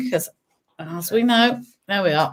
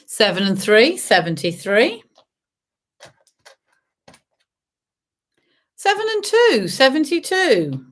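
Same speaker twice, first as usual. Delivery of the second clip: bingo caller; slower than usual. Acted. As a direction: sing out the numbers. Bingo calls.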